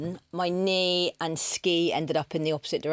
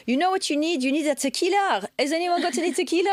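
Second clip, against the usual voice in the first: put-on high, bright-eyed French accent offering tequila; 12 semitones higher, 4 dB louder than usual. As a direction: play the cheerful French couple in a French accent. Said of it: "very good French accent".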